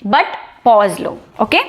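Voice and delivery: ordinary talk, nothing marked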